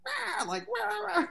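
very high robotic bird squawk; mock robot bird call. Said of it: "in a robot voice".

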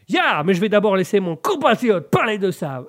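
loud mock-American French; boisterous American accent, hammed up; McDonus. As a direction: butt in like a stage American. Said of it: "monsieur McDonus est ici".

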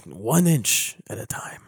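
whispered, slow and low; hushed whisper of the famous speech. Retold or imitated. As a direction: breathe the speech in awe.

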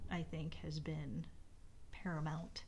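drops to a whisper; hushed whisper, sincere. Real, not acted. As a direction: let it fall to a breathy whisper. Trails into hushed words.

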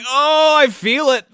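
slow, loud, high exclaim; a moved viewer gushes over the acting; mock swooning. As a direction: gush it out slowly and loudly. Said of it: "I was just like".

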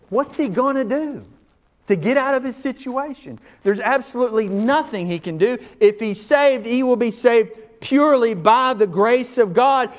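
high, loud, rising preacher's cry; full-force preaching. Acted. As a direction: preach it out to the whole room.

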